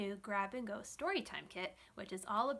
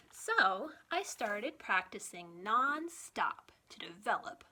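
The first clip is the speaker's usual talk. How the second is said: put-on reading to children; 5 dB louder, slower than usual. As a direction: read it like a children's librarian holding a picture book up to the kids. Reads picture book to kids.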